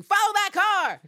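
loud, high, hammed-up order; dramatic command. Eve telling her taxi driver, dramatic shouted order. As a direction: belt it out like a film chase line.

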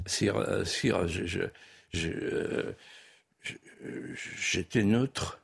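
low, halting mumble that nearly dies away; timid stammering. Retold or imitated. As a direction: act out an embarrassed subject faltering before the Emperor. Acted mayor stammering before the Emperor. (bredouillement craintif et hésitant, à voix basse)

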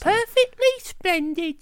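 high, slow posh English parody; mock posh British. Mocking a bad TV accent, high slow posh British. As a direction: ham up a prissy British child. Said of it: "It was like".

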